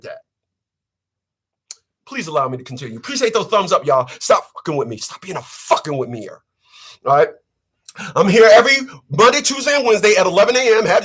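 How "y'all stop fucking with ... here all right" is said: usual speaking voice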